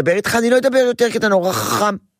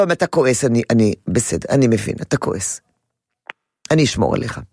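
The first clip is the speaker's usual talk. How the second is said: calm him down, low and gentle; soothing father; 6 semitones lower than usual. Shown as a demonstration. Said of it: "אתה אומר לו".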